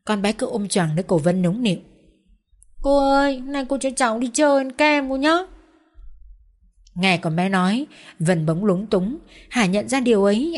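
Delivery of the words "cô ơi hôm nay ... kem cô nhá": put-on high, coaxing child's voice; wheedling little girl. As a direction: beg sweetly like a spoiled child. Child character's voice.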